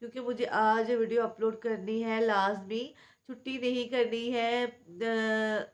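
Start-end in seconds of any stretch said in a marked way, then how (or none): none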